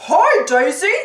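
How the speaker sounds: loud, swooping cartoon Pete; big put-on character call. A narrator's reading. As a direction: holler it as a cartoon heavy. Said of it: "he calls".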